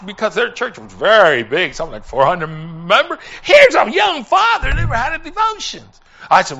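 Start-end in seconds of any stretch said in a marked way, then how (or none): 3.4-5.8 high, loud cry of disbelief; preacher's astonished outburst, pitched way up; astonished exclamation. Retold or imitated. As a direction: exclaim it to the congregation in amazement. Exclaims over father's inexperience.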